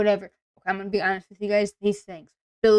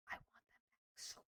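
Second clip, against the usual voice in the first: breathy whisper, almost to herself; whispered longing; 32 dB quieter than usual. Real, not acted. Streamer begging the brand for her childhood snack, whispered. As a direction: whisper it like a secret wish. Pleading for discontinued snack bars.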